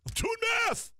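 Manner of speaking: high-pitched mock shriek; stretched, strangled comic shouting; Kyle snapping. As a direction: screech it as an exasperated caricature. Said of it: "And Kyle, like, looks at me".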